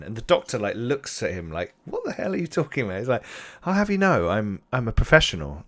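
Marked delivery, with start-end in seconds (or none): 1.8-3.0 quick, higher-pitched disbelief; incredulous retort. Acted. Doctor to the man who doubts him, incredulous. Doctor taken aback by the escort. 3.6-5.6 indignant protest. Acted. Offended professional answering back to the man beside him, indignant. Character defends himself as a professional.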